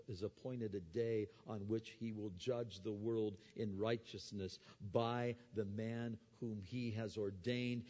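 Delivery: ordinary talk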